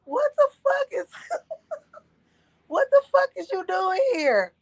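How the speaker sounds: high, laughing what the fuck; cracking up in shock at the door; shocked laughing disbelief. Retold or imitated. Daughter laughing in disbelief at her dad on the doorstep.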